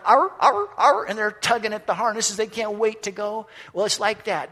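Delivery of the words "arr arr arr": high, loud mock sled-dog yelps; eager dog barks. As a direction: yelp like straining sled dogs. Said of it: "the dogs are going".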